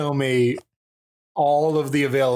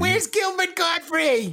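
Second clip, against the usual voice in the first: high, slow screechy whine; piercing Queens caricature; drawn-out squawking diva impression; Fran Drescher. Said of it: "real life Fran Drescher?".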